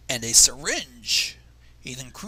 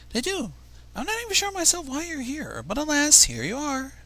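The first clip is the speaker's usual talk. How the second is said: Rarity voice; 11 semitones higher than usual. Acted. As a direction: introduce yourself grandly, pitched up. Reading Rarity's dialogue.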